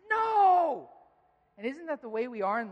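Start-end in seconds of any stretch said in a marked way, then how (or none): 0.1-0.9 high, loud defiant no; child shouting no. Retold or imitated. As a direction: shout it like a toddler refusing help. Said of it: "and he said".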